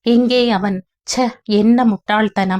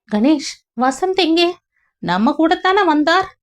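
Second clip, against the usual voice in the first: slightly higher, puzzled host; lightly raised Vyasan voice; 8 semitones higher than usual. Acted. Vyasan asking Ganesh where Vasanth went, lightly voiced. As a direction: ask it with mild surprise.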